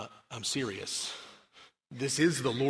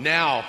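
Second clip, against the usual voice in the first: "Now" punched loud and held alone; emphatic NOW; 4 semitones higher, 11 dB louder than usual. Real, not acted. Preacher opens the Bible passage for the congregation, stress on "Now". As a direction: hit "Now" hard, well above talking level. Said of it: "I emphasize the word now".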